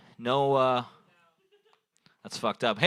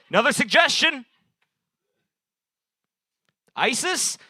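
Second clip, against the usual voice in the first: calling out; 11 semitones higher, 5 dB louder than usual. Real, not acted. Calling to crowd for suggestion.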